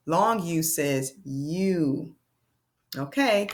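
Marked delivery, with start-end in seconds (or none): 0.1-2.1 you said long and slow; teacher modelling long U; long U sound. Shown as a demonstration. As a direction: stretch U into its name, you. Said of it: "vowel demo".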